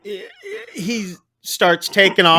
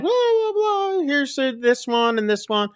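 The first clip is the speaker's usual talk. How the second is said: mock chirpy show-off; 10 semitones higher, 3 dB quieter than usual. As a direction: mimic her airy animal tour in a high voice. Pearl showing off animals, mimicked.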